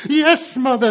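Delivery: put-on very high, loud child's wail; hammed-up bawling; bitter sobbing. A narrator's reading. Turkey crying to mother, sobbing bitterly. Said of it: "sobbed the turkey even more bitterly".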